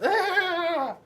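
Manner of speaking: high, loud startled shriek; high frightened yelp. Acted. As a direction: yelp and run off. Penelope runs away scared.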